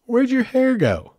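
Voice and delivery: put-on high, slow child voice, curious; imitating little boy. Hotel clerk's little son asks the bald guest, high slow child voice. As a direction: ask it wide-eyed like a small kid. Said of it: "he looks at me and goes".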